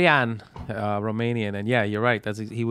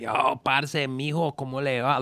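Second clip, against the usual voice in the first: imitated Colombian Spanish greeting; 4 dB quieter, much slower than usual. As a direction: put on a thick Colombian accent. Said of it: "Like, very Colombian".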